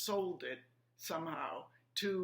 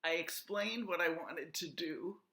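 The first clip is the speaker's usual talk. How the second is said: ordinary talk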